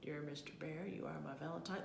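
low, soft bear voice; reading aloud. A narrator's reading. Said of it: "read them aloud".

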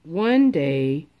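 usual speaking voice

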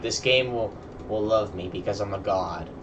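usual speaking voice

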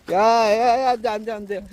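high, loud no-no to the dog; talking to a dog. Real, not acted. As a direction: shout to stop the dog. Stopping the dog. (강아지를 높고 크게 말리는 소리)

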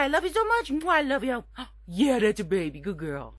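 usual speaking voice

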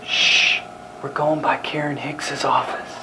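soft sneaking whisper. Acted. Sneaking past Karen's office in a whisper. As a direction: hush as you creep past.

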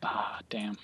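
whispered. Real, not acted. Disappointed aside.

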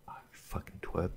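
breathy whisper, no voice; whispered insult. Real, not acted. Owner whispering fond insult at stubborn dog. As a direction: mutter it at the dog under your breath.

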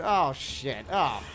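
ordinary talk, nothing marked

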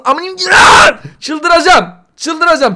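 frantic embarrassment. Retold or imitated. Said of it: "Çok utanıyorum".

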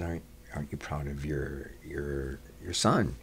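soft, hushed retelling of a friend's question. Retold or imitated. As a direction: ask it softly, as if leaning in to the mom. Aunt's friend asking mom quietly.

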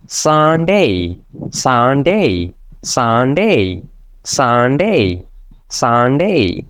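সানডে slowly with a long 'saan' and falling 'day'; drawn-out Sunday. Shown as a demonstration. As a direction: stretch both syllables of সানডে, drilling it evenly. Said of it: "এটা একটু টান দিতে হয়". (টান দিয়ে ধীরে 'সা-ন-ডে')

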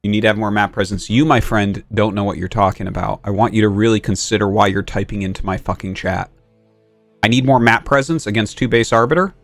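fast, low exasperated rant; raging gamer. Real, not acted. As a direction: rattle it off annoyed. Ranting at chat advice.

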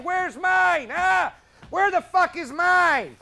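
mocking a loud, high, whining demand; mock whiny scream. Cop mocking groups who demand handouts, with a mock yell. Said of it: "screaming, crying".

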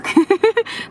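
high, short giggle; chuckle. Real, not acted. Storyteller teasing the listeners with a question, amused chuckle. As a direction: chuckle at your own teaser.

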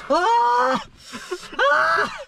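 sharp pained cry rising to a shout; pained yelp. Real, not acted. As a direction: yelp as the chilli burns. Eating very hot chilli.

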